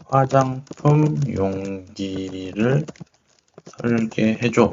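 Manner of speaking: very slow, syllables drawn out; dictating while typing. Real, not acted. User typing a design command to the program, dictating slowly. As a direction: stretch each word out as you type it.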